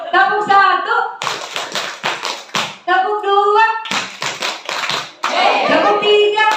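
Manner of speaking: teacher calling out the clap count with energy; leading clap count. Shown as a demonstration. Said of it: "Ya, ulangi ya".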